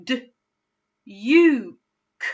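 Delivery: D, u, k said as separate sounds; sounding out. Shown as a demonstration. Teacher sounding out duke again. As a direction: segment D, u, k one at a time. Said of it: "Let's do that one more time together".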